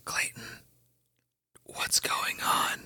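unvoiced hushed whisper. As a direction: whisper it so no one hears. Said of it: "I'm gonna whisper to Clayton".

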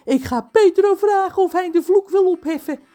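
decide it through the last tears; hopeful cook. Acted. Cook John tells the giant his plan. John resolves to lift the curse.

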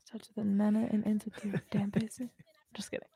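teasing breathy whisper. Real, not acted. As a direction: whisper the joke coyly.

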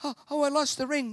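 a hint of a distraught hobbit; Frodo despairing. Frodo crying to Sam about the ring, lightly voiced despair. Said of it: "Mister Frodo's like".

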